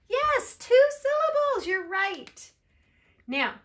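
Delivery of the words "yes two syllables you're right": very high, loud cheer; excited praise. Real, not acted. Teacher cheering the two-syllable answer, high and excited.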